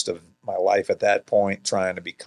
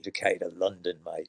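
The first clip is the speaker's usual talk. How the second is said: mock English accent; 7 dB quieter than usual. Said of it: "came back with an English accent".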